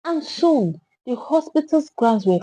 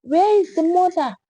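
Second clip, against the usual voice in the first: shouted; 7 semitones higher than usual. A narrator's reading. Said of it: "Another voice shouted".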